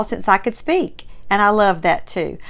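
ordinary talk, nothing marked

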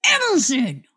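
sharp call across a store; yelled name. Retold or imitated. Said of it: "And I just went".

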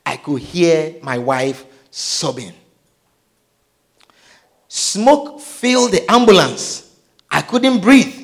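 normal talking voice

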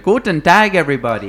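high, loud mock German hello; hammed-up cartoon German hello; Simpsons German greeting. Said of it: "it was on The Simpsons".

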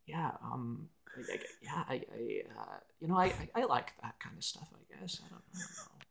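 mock shy, trailing-off mumble; nervous mumble. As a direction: play the tongue-tied, shrinking guest. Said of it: "my entire feedback was just".

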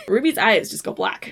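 ordinary delivery

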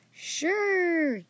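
high, drawn-out sheepish reply. Acted. Friend doubts the boy's claim, sheepish. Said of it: "he said sheepishly".

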